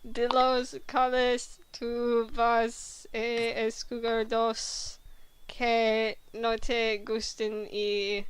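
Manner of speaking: slow, word-by-word reading of Spanish; halting Spanish. Shown as a demonstration. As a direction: stumble slowly through the Spanish like a learner. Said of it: "Me reading horrid Spanish".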